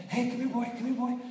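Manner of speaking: mock call to a dog. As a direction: coax a puppy to come. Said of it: "If you say".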